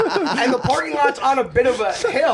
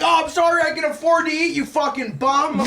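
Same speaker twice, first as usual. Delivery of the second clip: raised, sarcastic yelling; mock yelling; 6 semitones higher than usual. Screaming at a homeless guy, high sarcastic yell. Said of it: "I started screaming at this homeless guy".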